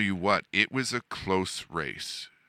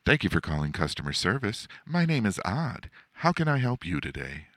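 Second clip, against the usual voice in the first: higher-pitched, brisk customer service greeting; 3 semitones higher than usual. Shown as a demonstration. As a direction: put on a perky phone-agent voice, pitched up. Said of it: "that's not necessarily always my customer service voice".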